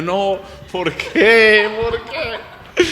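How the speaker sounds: high, very slow, laughing scared protest; drawn-out frightened no, why, half laughing. Retold or imitated. Refusing the nuns who say help, in a high laughing scared voice.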